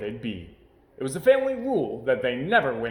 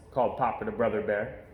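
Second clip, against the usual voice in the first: plain reading voice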